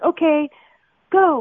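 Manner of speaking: high, loud retold command; crew's cue. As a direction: call it out like a cue to start.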